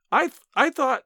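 high, breathless gasp of amazement. A narrator's reading. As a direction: gasp her name out in shock, rising. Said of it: "I gasped".

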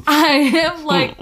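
ordinary talk, nothing marked

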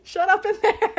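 high mock shout, half laughing; imitated yell from outside. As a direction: mimic an irritated yell from outside, amused. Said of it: "yelled from outside, like".

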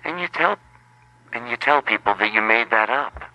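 a hint of a nagging mother; lightly voiced scolding mom; Todd's mom. A mother scolding Todd on the phone, lightly nagging. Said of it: "it's your mother".

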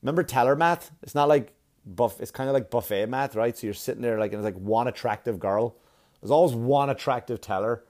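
ordinary delivery

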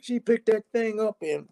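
high-pitched excited retelling of the rake hit. Retold or imitated.

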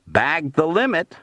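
high, taunting mimicry; pitiless sarcastic parody. A narrator's reading. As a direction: mimic him like one child taunting another. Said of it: "parodied mine with the pitiless sarcasm".

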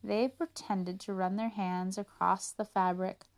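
plain reading voice

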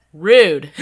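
loud, blurted retort; one-word retold outburst; really loud. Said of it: "like, really loud".

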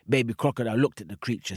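ordinary delivery, nothing marked

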